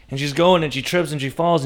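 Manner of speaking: ordinary delivery, nothing marked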